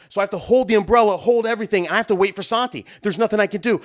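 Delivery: normal talking voice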